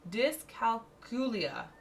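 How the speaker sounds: "Dyscalculia" said slowly, syllable by syllable; careful slow pronunciation. Real, not acted. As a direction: pronounce "Dyscalculia" slowly and deliberately. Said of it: "I can't pronounce it".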